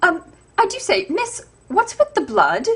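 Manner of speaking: a hint of a fussy gentleman asking politely; mild mock-gallant prince. Prince voiced questioning bleeding stepsister.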